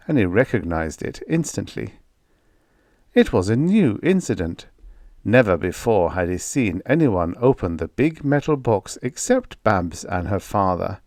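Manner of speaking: plain reading voice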